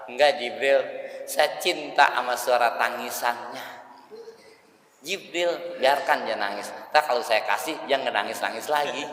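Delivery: a gentle, unhurried answer; God answering. Acted. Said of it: "Kata Allah Ta'ala".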